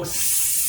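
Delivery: sustained, steady hiss; imitated hissing, drawn out; long snake hiss. Shown as a demonstration. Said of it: "I let out a hiss".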